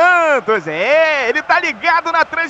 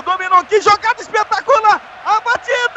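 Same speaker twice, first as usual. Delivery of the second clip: rising toward the shot, ever higher; building excitement; 7 semitones higher than usual. Real, not acted. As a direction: voice climbs as the chance builds. Attack builds to goal shot.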